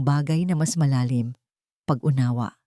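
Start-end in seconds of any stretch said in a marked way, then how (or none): none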